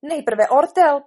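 loud, high, slow insistence; protesting. Acted. Queen overrules the king on procedure, protesting. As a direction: insist on it, slowly and loudly. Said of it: "protestovala královna".

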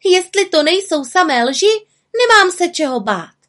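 high, loud defiant little witch; piping, indignant character outburst; crying out. A narrator's reading. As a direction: protest loudly in a high voice. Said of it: "vykřikla malá čarodějnice".

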